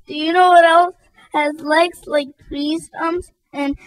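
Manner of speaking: high voice choking up; weeping. Acted. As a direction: falter into tears. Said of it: "The weeps took over".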